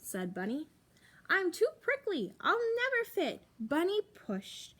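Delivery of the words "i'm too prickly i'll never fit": higher, fretful little voice; a nervous porcupine squeaking up; worried porcupine. Acted. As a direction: fret about being too prickly. Porcupine fretting at the slot.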